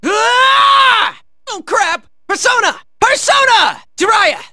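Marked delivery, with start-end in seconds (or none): 0.0-1.2 long, high "aah" yell; battle cry. Acted. Fighter unleashing an attack, long high yell. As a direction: let out a drawn-out fighting roar.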